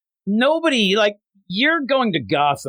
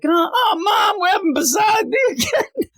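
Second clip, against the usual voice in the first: hammed-up kid wail, pitched way up; whining child. Kid to Mom about dinner again, high mock wail.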